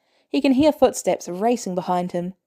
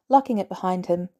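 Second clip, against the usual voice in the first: ordinary delivery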